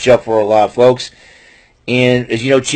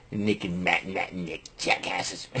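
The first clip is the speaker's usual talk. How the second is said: mocking under breath; 9 semitones lower, 15 dB quieter, slower than usual. Mocking the Young Bucks.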